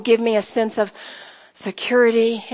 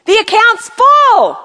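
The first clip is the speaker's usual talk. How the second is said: higher, louder and slow, each word weighted; emphatic declaration; 9 semitones higher, 7 dB louder, slower than usual. Shown as a demonstration. Preacher gives the ladies a line to echo, slow and ringing. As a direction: proclaim it so they repeat it. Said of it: "Say that with me".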